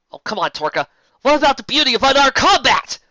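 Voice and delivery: high, loud mocking challenge; brash pegasus heroine; cocky heroine. A narrator's reading. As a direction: rile the villain up. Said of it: "Raindrops challenges Torka".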